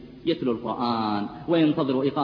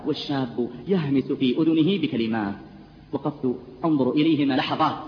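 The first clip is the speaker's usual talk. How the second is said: usual speaking voice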